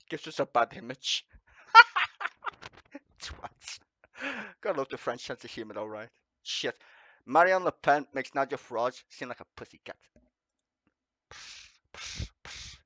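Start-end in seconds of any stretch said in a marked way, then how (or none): 1.7-2.7 high squealing burst of laughter; shrieking laugh. Real, not acted. 11.3-12.8 hissing cat sounds; cat noise. Acted. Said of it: "That's me trying to be a cat".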